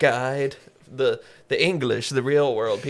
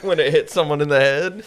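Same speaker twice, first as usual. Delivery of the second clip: ordinary talk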